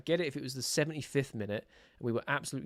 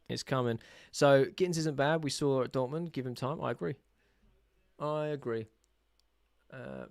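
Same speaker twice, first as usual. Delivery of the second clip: ordinary talk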